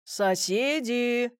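slow, drawn-out hail; calling out. A narrator's reading. Major hails the neighbours at the gate, calling out slowly. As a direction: stretch it as if hailing a house.

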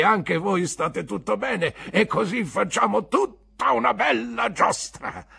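put-on cheerful old sailor explaining; hearty fisherman. Peggotty's long speech. (vecchio marinaio cordiale, più alto)

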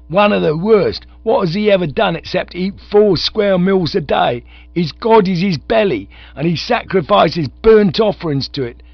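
loud, high soapbox rant; ranting orator. Acted. Bearded agitator denouncing Bertie to the crowd, ranting loudly. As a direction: harangue the crowd about idle rich.

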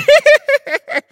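very high, loud giggle; robber's laugh. A narrator's reading. Robber amused at his own jibe, giggling. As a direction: cackle at your own joke. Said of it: "засмеялся разбойник".

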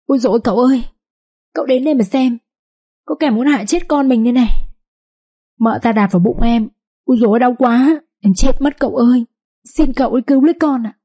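milk a fake bellyache for the husband's pity; fake moaning in pain. A narrator's reading. Concubine fakes pain to win her husband over, high whiny moaning. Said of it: "nhăn mặt lên rên hừ hừ".

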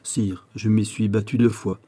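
plain reading voice

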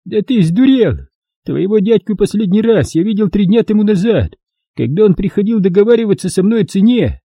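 high, outraged trader's voice; indignant merchant. A narrator's reading. Bound merchant threatening his captors, indignant. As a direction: bluster and threaten from the ground.

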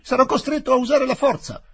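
high, loud desperate cry; a frightened man threatening force; panicked wail. Acted. Inspector crying out as Aziz tries to escape, high and loud. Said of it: "gemette mister Huck".

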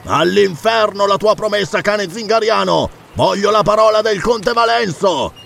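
high, defiant calling; angry shouted retort. Acted. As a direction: hurl the insult back at your rival. Pirate rejects rival's promise angrily.

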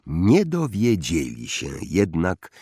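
usual speaking voice

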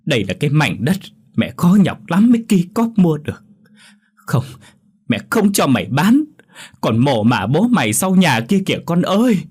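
mother wails high and loud; wailing cry. A narrator's reading. Old mother to son selling her land, loud anguished wail. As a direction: cry out in despair. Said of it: "Bà Nga gào lên".

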